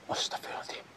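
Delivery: very soft whispered prayer. Real, not acted. Ghost hunter whispers a prayer at empty rock, spooked whisper.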